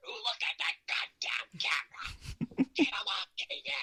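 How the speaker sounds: high, mocking imagined boss. Imagined Vince McMahon mocking him from the monitor, high mock sneer. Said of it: "That's what I was hearing in my head".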